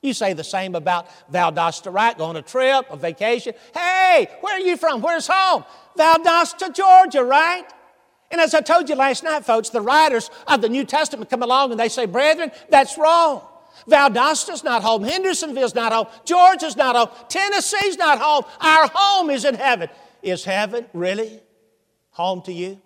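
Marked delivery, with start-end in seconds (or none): 3.7-5.6 high, loud, hearty hail; calling out. Acted. Stranger hails a vacationer about home, calling out loudly. 6.0-19.9 high, loud, emphatic sermon voice; full-force preaching. Real, not acted. Preacher corrects congregation about their true home, full-force preaching.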